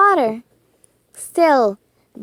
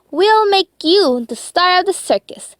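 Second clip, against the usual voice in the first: confident; 7 semitones higher than usual. A narrator's reading. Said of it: "suggested Timothy confidently".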